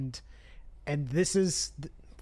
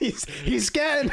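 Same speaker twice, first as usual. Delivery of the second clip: laughing; 10 semitones higher, slower than usual. Real, not acted. Host breaking up laughing.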